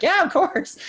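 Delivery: lightly voiced cheerful offer; friendly yes. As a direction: brightly say sure, sit down. Retold friendly reply.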